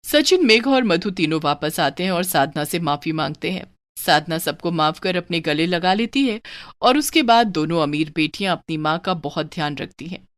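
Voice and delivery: ordinary delivery, nothing marked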